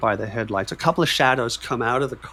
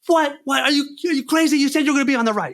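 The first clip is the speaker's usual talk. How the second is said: imitated outrage; 12 semitones higher, faster than usual. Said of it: "He's, he's going apeshit".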